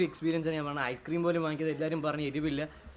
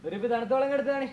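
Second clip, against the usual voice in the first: urgent; 8 semitones higher, 6 dB louder than usual. Real, not acted. Desperate for cold water.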